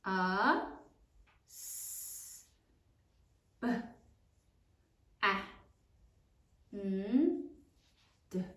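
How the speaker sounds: A, s, b, a, n, d as single sounds with long gaps; sounded out while writing. Shown as a demonstration. Teacher modelling spelling for pupils, A, s, b, a, n, d sound by sound. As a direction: leave writing time after each sound: A, s, b, a, n, d. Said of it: "say it as you write it".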